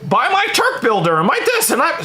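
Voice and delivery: mocking salesman voice; mock supplement shill. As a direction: parody a pushy ad plug. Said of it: "And then".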